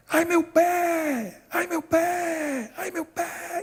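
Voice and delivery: mock child's wail, high and drawn out; long high cries of pain without full volume, fading; imitated wailing. Boy with a cut foot crying out in hospital, mock wailing. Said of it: "E o menino gritava assim".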